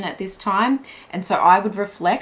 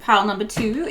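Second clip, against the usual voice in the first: ordinary talk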